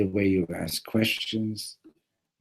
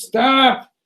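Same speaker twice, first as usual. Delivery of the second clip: very high, loud scream; 14 dB louder than usual. Retold or imitated. Rabbi interrupts the second blessing, screaming. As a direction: cut in with a piercing scream. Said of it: "Rabbi Leibel screams".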